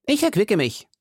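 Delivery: high put-on voice, brisker take; hearty musketeer. Host excuses his rich meal, high character voice. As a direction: say it high and matter-of-fact.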